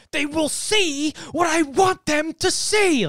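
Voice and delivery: high, slow, loud wacky screech; childish director screaming. Retold or imitated. Imitating Mysterio as a childish director, wacky screaming. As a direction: throw a hammy director's tantrum, word by word. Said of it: "like a childish director".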